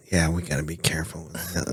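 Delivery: low, quiet mock imitation. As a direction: imitate him low and soft. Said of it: "That's what you sound like".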